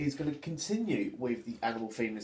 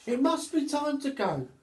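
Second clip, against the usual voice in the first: high, louder, gleeful monkey voice; little monkey thrilled to start climbing; excited little monkey; 7 dB louder than usual. Acted. Monkey decides to go down.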